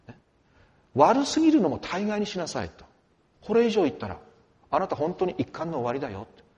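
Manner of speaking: usual speaking voice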